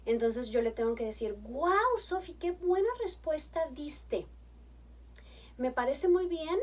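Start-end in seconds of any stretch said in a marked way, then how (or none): 1.4-6.6 enthusiastic praise. Shown as a demonstration. Mother praising her five-year-old daughter, delighted and slow. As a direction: cheer her answer like it's wonderful. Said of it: "¿Sí, sí se fija mi tono de voz?".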